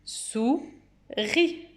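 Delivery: "Souris" slowly as sou, long pause, ri; slow, detached "Sou-ris"; syllable by syllable. Shown as a demonstration. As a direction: leave a clear gap inside "Souris". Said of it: "où se trouve le son I".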